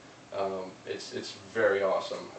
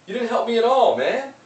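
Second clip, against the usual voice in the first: much higher, louder outraged whine; indignant complaint; 11 semitones higher, 7 dB louder than usual. Retold or imitated. Man in hole protests doctor leaving, high indignant whine. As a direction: call out the doctor who walked off. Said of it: "The guy's like".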